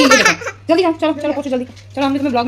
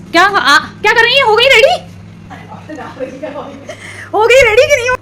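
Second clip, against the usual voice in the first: calling out; 9 semitones higher than usual. Real, not acted. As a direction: call her to hurry and get ready. Calling the girl to get ready.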